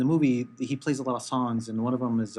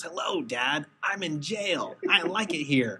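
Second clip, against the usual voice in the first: higher, put-on voice from a song; quirky song caller; 4 semitones higher, slower than usual. Host quoting a song's son calling his dad from jail, quirky put-on voice. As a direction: imitate the odd caller, pitched up. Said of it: "and the way he speaks, he goes".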